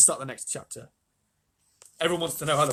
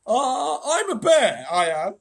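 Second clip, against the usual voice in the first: high, loud, simple-hearted bear; thick-voiced old bear; 9 semitones higher, 10 dB louder, much slower than usual. A narrator's reading. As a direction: announce yourself like a big dopey bear. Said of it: "sleepy voice said".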